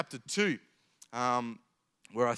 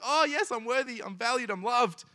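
higher, bubbly, thrilled; giddy delight; 9 semitones higher, 3 dB louder than usual. Retold or imitated. New couple gushing to themselves, giddy and high. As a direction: gush like it's the best day ever.

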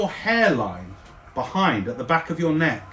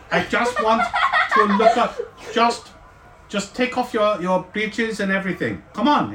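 excitable artificer; 6 semitones higher than usual. Acted. NPC examines player character.

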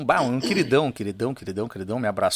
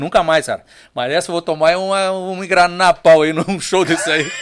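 laughing; 4 semitones higher, 8 dB louder than usual. Retold or imitated. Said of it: "eu disse pra ele".

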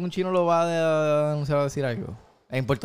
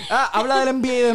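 mock taunt; 6 semitones higher, 4 dB louder than usual. Retelling movie taunt.

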